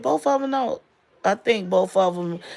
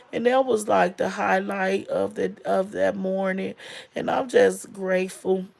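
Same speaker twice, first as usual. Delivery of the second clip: ordinary talk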